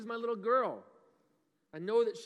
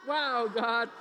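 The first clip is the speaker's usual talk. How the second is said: louder, bright cheer of Wow God; excited call; 3 semitones higher, 8 dB louder than usual. Pretend. Pastor leads kids in the cheer, excited. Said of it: "That's why we say".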